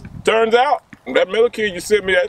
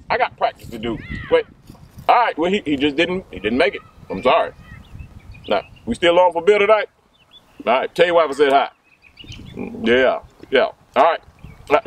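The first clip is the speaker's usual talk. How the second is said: ordinary talk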